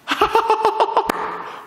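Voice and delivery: high, airy mock laughing; imitated laughter. Neighbors heard through the wall during a quarrel, mock laughter. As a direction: laugh like the neighbors next door. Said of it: "웃음소리가 들리는 거예요".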